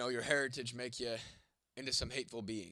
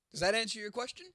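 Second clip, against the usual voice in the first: mocking; 10 semitones higher, 7 dB louder than usual. Real, not acted. Mock retort to chat.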